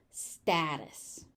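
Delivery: "Status" with the middle t as a soft d-like tap; lightly tapped t inside "Status"; flap T. Shown as a demonstration. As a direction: say "Status" as STA-dus. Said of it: "T in the middle is just a flap T".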